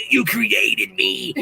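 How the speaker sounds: very slow, low, eerie accusation; very slow, deep creepy voice of the woman ramming her car; creepy lady quote. Retold or imitated. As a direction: drag it out low like a haunted stranger. Quoting the strange ramming lady.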